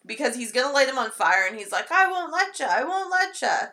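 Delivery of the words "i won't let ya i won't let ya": much higher mock leprechaun voice; threatening leprechaun. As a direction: imitate a little creature's high-pitched threat. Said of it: "seems like the leprechaun brings on".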